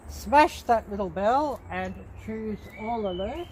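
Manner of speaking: ordinary delivery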